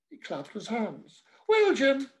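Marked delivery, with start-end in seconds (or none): 1.5-2.1 hearty priest. Acted. Priest wraps up.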